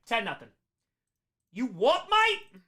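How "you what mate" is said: put-on British accent shooting up high on MATE; hammed-up British challenge, pitch leaping at MATE; mock British accent. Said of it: "hear a terrible British accent".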